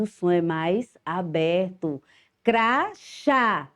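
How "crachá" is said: Crachá said higher, open chá; model word. Shown as a demonstration. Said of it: "então é acento agudo".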